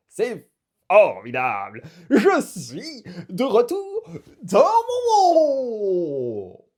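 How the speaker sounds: huge, loud cry of triumph; exultant shout. Acted. Borel is back in his own world, shouting exultantly. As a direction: bellow it out, stretching the end.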